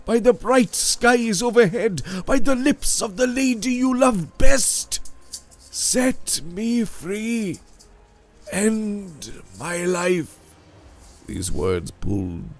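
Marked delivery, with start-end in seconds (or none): 0.1-7.6 desperate. A narrator's reading. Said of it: "cried out in a desperate tone". 8.5-10.4 high, very slow, falling in despair; desperate plea. A narrator's reading. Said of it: "cried out in a desperate tone".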